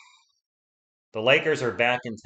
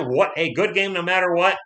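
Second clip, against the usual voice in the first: ordinary talk, nothing marked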